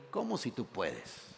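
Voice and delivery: low, soft mock disbelief; quiet Jesus. Jesus answering the doubting father: low, soft disbelief. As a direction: let it drop low and quiet in gentle rebuke. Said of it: "Jesús le dijo".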